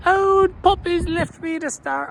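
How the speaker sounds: put-on high, drawn-out child's wail; whining young'un. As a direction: wail like an abandoned Irish kid. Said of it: "the young'uns would see".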